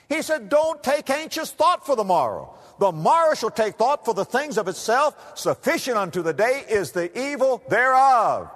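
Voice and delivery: thunderous revival preaching over music; full-force preaching. Acted. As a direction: lift the church to the peak.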